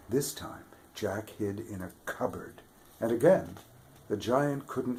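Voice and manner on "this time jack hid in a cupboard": ordinary delivery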